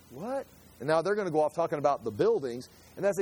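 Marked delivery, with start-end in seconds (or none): 0.1-0.5 baffled what. Pretend. Mock baffled reaction.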